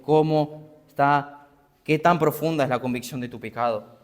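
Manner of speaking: ordinary talk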